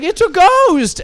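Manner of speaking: very high, loud mock-fright shriek; mock panicked cry. Wife crying out about a ghost, mock panicked. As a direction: ham up a terrified wife.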